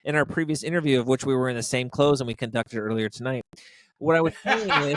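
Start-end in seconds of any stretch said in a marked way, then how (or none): 4.0-5.0 louder, laughing through the words; breaking into laughter. Real, not acted. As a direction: crack up mid-explanation. Cracks up while explaining himself.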